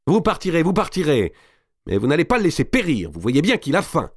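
high, pressing appeal; urgent persuasion. A narrator's reading. Madame de Bouville presse Marie de céder. (persuasion pressante, voix aiguë)

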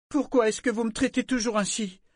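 high-pitched tearful outburst, acted; éclat en larmes. A narrator's reading. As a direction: wail it as a hurt girl. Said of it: "elle éclata en larmes". (plainte larmoyante et très aiguë)

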